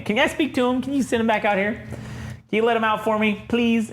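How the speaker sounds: pleading woman. Retold or imitated. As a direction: coax in a high, put-on woman's voice. Retold woman pleading to the Hulk.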